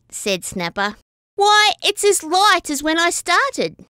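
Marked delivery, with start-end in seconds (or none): 1.4-3.9 high, puzzled exclaim; surprised. A narrator's reading. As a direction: pitch it up in surprise. Snapper boasts, bucket actually empty.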